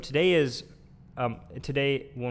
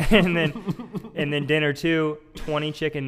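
laughing; 3 semitones higher, 7 dB louder than usual. Real, not acted. Laughing through food list.

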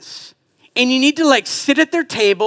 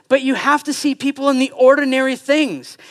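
ordinary talk, nothing marked

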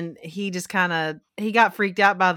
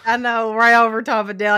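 ordinary delivery

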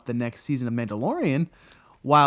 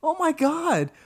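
much higher, exasperated shout; exasperated outburst; 14 semitones higher than usual. Retold or imitated. Fan reliving the dumb fight scene, exasperated high shout. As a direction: yell it at the screen in disbelief. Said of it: "I'm like".

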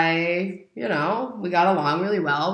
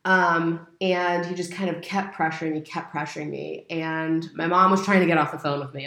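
ordinary delivery

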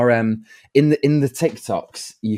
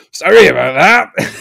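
louder, hearty giant voice; Hagrid impression; 6 semitones higher, 9 dB louder than usual. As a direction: boom it out like a big friendly groundskeeper. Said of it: "You Hagrid!".

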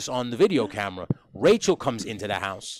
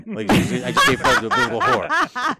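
laughing; 11 semitones higher, 6 dB louder than usual. Real, not acted. Laughing at the baby name joke.

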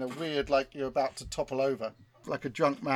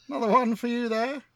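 James Acaster impression; 9 semitones higher, 4 dB louder than usual. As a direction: do the comedian's odd take on "Chrisos", pitched up. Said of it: "That was a weird impression".

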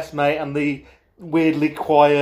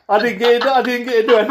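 higher, louder and laughing; hurried embarrassed denial; mock flustered denial; 8 semitones higher, 4 dB louder, faster than usual. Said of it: "You're like".

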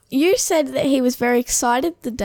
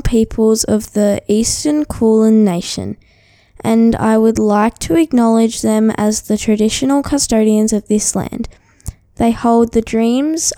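loud formal announcement read; 8 dB louder than usual. Acted. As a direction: read it like an official statement. Acknowledgement of country read formally.

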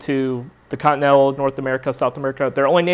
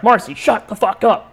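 higher-pitched exasperated outburst; imitated snapping; 7 semitones higher than usual. Stressed husband to his wife in the car, mock snapping. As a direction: act out a husband losing his patience. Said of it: "Just snaps at her".

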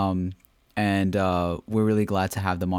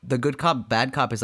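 ordinary talk, nothing marked

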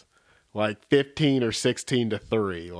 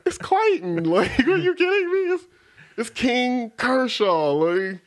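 high-pitched, laughing mock disbelief; incredulous protest; 11 semitones higher, 4 dB louder than usual. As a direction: plead the obvious in a squeaky, comic protest. Acted player protesting to the skipper.

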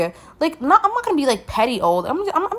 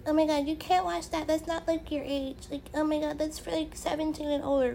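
higher, softer mock-scolding voice; mocking nosy people; 4 semitones higher, 11 dB quieter than usual. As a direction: imitate a busybody tut-tutting, lightly. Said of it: "I'm so sick of people like".

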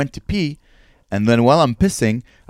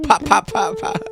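gunshot sounds. Pretend. Imitating gunshots.

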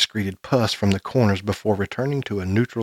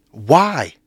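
sudden louder higher outburst; blurted; 5 semitones higher, 5 dB louder than usual. A narrator's reading. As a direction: blurt it out before you can stop. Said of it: "I blurted out".

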